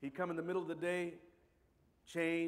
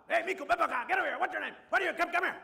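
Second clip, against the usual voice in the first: booming calls across the house; loud-talking dad; 12 semitones higher, 9 dB louder, faster than usual. Retold or imitated. Dad calling his son over, loud and booming. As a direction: call the kid over at full volume. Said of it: "My father just talked loud".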